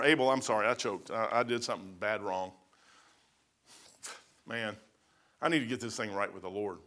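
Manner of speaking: low, soft, humbled admission; acted remorseful confession. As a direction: own up quietly to a wronged brother. Imagines Cain confessing to his brother.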